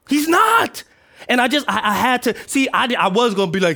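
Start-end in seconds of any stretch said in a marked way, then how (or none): 0.1-0.8 high, loud burst; emphatic insistence. Real, not acted. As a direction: drive it home to the congregation. Insisting after congregation murmurs.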